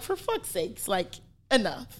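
exasperated mutter. Retold or imitated. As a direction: mutter it under your breath, done with it. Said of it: "And I'm like".

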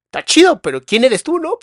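high, loud mock-puzzled questioning; retold baffled "who are you?"; puzzled challenge. Acts out questioning the stranger.

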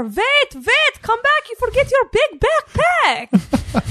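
high shouted call in a put-on Czech accent; mock Czech shout. Daughter retells yelling to a friend, in a high Czech-accented call. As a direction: call someone back from the car, accented. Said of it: "So I scream out of car".

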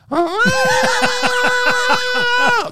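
high burst of real laughter; big laugh. Real, not acted. Teller laughs about the Zeppelin every night. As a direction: crack up at the absurdity.

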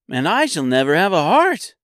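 high, loud character voice; high-pitched tin man's lament; lamenting Tin Woodman. A narrator's reading. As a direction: declare it high and emphatic.

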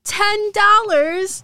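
high, loud, slow disbelieving question; surprised. Acted. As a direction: stretch out the shock at the tiny tip. Disbelief at small tip.

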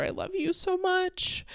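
high, soft loving voice; softly re-enacted affection; tender. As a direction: coo it gently to a newborn. Retelling first words to newborn daughter.

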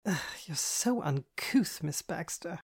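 high, slow, prim distaste; disgusted prissy. A narrator's reading. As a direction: recoil in mock disgust. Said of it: "Andy grimaced".

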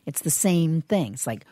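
ordinary delivery

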